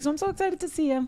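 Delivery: high excited gush. Real, not acted. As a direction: gush about seeing him. Gushing about seeing the baby.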